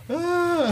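high-pitched little "ah" of alarm; mock frightened cry. Tripping rider expecting dinosaurs and a drop, mock frightened cry. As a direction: let out a comic, high yelp. Acts out fear of dinosaur ride.

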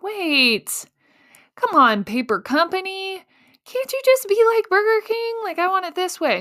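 high, whiny mock plea; whiny pleading. Founder whining at the paper company to be like Burger King. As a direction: whine like a kid wanting it her way.

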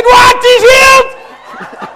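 high, loud, stretched cry of disbelief; astonished cry. Retold or imitated. Preacher crying out at news of the healing. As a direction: gasp it out in amazement.

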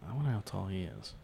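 ordinary talk, nothing marked

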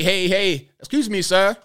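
lightly mimicked, high-pitched calling out; imitated attention-getting call. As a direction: imitate someone trying to flag a man down. Woman trying to get his attention.

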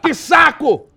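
high, loud fed-up yell, slowing on pai; exasperated stage shout. Acted. Son cuts off his father's monologue, shouting high and loud. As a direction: shout him down in mock despair.

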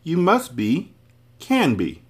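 high, bright reveal with a pause before the name; bright conclusion. A narrator's reading. Milo tells the man who he is, brightly. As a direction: lift it brightly, land the name. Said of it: "Milo concluded brightly".

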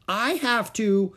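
put-on high, loud, drawn-out child's plea; urgent plea. A narrator's reading. Little girl pleading with Mother to go next door, urgent and loud. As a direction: beg Mother with all urgency. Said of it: "insisted Ramona even more urgently".

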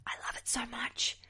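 whispered sarcastic text, imitating a heckler; mock whisper. Comic mimicking the phone user at her show, in a mocking whisper. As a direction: breathe it out as a snide little whisper. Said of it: "Maybe he was sending a text going".

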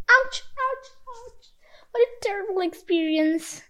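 high squeaky whimpers of pain; a small creature stung by burns; hurt raccoon. A narrator's reading. Burnt raccoon rushing home to Jinbi, high pained squeals.

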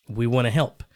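ordinary delivery

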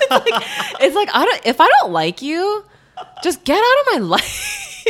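high, giggling through the words; laughing. Real, not acted. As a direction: laugh while you say it. Laughing through her own rant.

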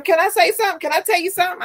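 high-pitched, tentative request; caseworker asking leave. Retold or imitated. Caseworker about to share something with the applicant, tentative. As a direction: pitch it up and ask permission.

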